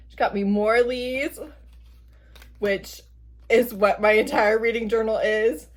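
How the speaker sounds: ordinary talk, nothing marked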